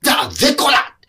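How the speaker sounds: Beverly turning the tables on her carer, shouting; defiant outburst. Retold or imitated. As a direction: snap back in a childish tantrum. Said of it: "まさかの逆ギレ".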